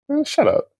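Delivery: high, incredulous "man, shut up"; stunned disbelief. Retold or imitated. To his agent on hearing of the trade, shocked disbelief. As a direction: sound like you can't believe the news. Told he was traded to Dallas.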